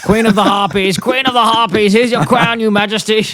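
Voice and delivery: very high cartoon impression; put-on character voice. Podcaster quoting a character for laughs, hammed-up high voice.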